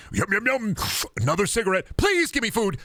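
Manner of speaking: hammed-up desperate begging; whiny starving beggar. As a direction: squeal for food like a starving wretch.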